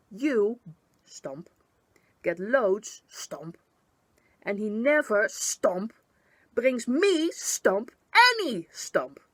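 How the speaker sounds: loud, building tantrum shout; angry outburst. A narrator's reading. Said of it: "Her outburst done".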